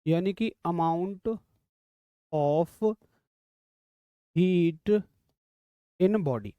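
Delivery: soft, drawn-out words with long gaps; slow dictation. Real, not acted. Teacher dictating a definition to students, slow dictation. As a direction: dictate it softly, piece by piece. Dictates notes while writing them out.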